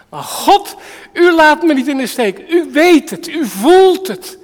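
a sufferer crying out loud to God for help; loud pleading prayer. Acted. Lazarus praying to God.

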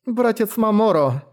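high, slow drawn out cry; drawn out call. Acted. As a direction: stretch the name out high. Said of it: "протянула Сиан". (протяжный зов)

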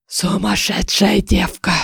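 put-on slow, grumbling old man's voice; displeased old man. A narrator's reading. Old oracle to the noisy intruder, displeased and slow. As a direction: scold like a cranky old-timer. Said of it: "Недовольно высказался старческий голос".

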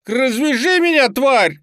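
very high, loud, furious screech; enraged squeal. A narrator's reading. Bound boy demanding to be untied, enraged squeal. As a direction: squeal it at full force. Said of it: "завизжал Лёня".

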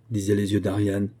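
ordinary delivery, nothing marked

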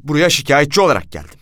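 high, loud protest, building; outraged complainant digging in; indignant refusal. A narrator's reading. Outraged narrator refuses to sign.

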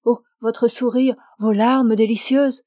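plain reading voice

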